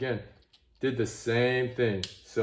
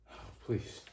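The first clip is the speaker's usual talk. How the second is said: whispered plea; 10 dB quieter than usual. Real, not acted. Screw about to strip.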